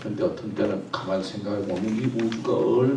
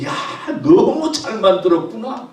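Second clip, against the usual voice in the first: much higher and louder, bursting with glee; overjoyed exclamation; 11 semitones higher, 8 dB louder than usual. Shown as a demonstration. God delighted with humankind, loud joyful exclaim. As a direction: cry it out like a thrilled craftsman. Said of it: "막 얼굴에 막 웃음이 넘치고".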